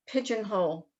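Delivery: ordinary delivery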